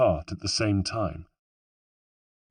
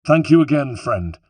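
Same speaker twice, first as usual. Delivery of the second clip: called out; 5 semitones higher, 7 dB louder than usual. Acted. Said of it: "he called".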